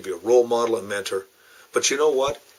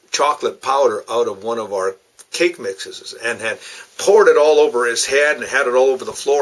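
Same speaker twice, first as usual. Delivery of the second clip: ordinary delivery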